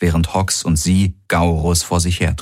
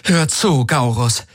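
harsh; 7 semitones higher, slower than usual. A narrator's reading. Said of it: "mit aller Härte".

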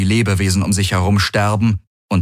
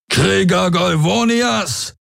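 high, drawn-out war cry; rally the warriors as their commander; slow, soaring commander's call to arms; battle call; 12 semitones higher, slower than usual. A narrator's reading. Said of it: "rief Daraios".

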